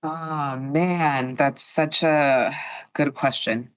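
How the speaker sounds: normal talking voice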